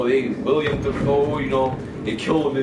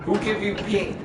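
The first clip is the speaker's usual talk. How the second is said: mocking; 9 semitones higher than usual. Real, not acted. Mocking Kylo Ren's angst.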